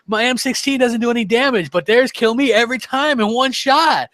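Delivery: high, whiny imitation of a teammate griping about his gun; mock gamer whine; complaining teammate. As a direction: mimic a friend moaning that his weapon does no damage. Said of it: "he was just complaining".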